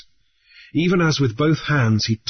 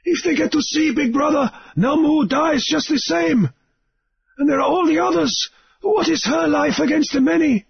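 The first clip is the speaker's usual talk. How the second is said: Namu pleading. A narrator's reading. Captive Namu urges Grief to throw the dynamite, pleading.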